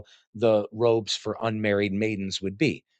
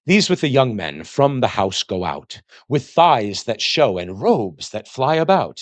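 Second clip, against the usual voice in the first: put-on slow, measured recital of old verse; poem reading; 5 dB louder, slower than usual. Lecturer reading verse to the class, slow recital. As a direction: read the lines out like a poem.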